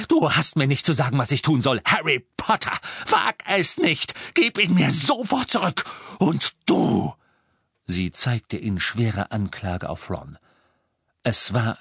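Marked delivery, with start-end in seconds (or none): 0.1-7.1 high, loud, furious warning; losing all composure. Acted. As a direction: order him off in a rage. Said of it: "derart die Beherrschung verlieren sehen".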